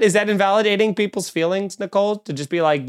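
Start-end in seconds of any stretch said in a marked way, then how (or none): none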